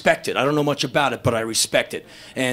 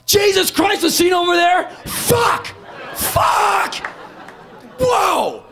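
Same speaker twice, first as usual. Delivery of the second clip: amazed screaming; much slower than usual. Retold or imitated. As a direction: jump up and down and scream it out. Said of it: "screaming, 'Fuck'".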